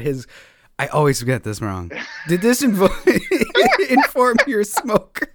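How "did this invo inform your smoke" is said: slow words broken by real laughter; cracking up while asking the question; laughing. Real, not acted. Host breaks into laughter asking about the smoked meat business.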